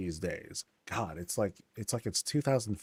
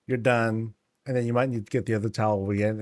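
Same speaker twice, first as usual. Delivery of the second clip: ordinary talk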